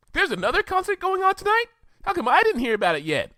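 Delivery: very high, bubbly pony voice; Pinkie Pie voice. Acted. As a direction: bounce way up high, all excitement.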